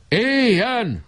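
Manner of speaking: high ringing cheer. A narrator's reading. The members cheering the motion, high ringing cheer.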